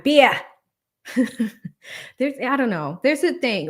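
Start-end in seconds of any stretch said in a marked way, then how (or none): 0.0-0.4 "Beer" as a loud, high Boston "beah"; Boston accent. Shown as a demonstration. Streamer repeating "Beer" on a viewer's request, Boston accent. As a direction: drop the R in "Beer", Boston style. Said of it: "Say beer one more time".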